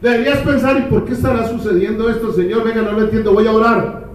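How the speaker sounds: high, insistent pulpit voice; preaching at full force. Acted. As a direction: drive it home to the hearers. Preaching at the congregation.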